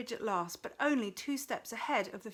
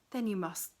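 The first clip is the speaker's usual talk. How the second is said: ordinary delivery, nothing marked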